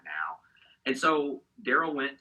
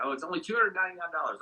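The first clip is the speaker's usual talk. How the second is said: fast, higher, breezy dismissal of the cost; 8 semitones higher, faster than usual. Retold or imitated. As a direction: toss the price off lightly. Friend breezily quotes a price.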